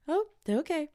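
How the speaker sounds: normal talking voice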